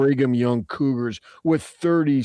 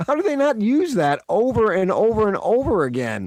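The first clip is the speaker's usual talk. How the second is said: high, cracking voice; 8 semitones higher than usual. Real, not acted. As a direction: voice climbing and cracking with excitement. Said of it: "his voice is getting high and cracking".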